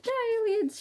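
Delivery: battle cry in a tiny high voice; squeaky battle cry. Acted. Bazooka attacking the aliens, squeaky war cry.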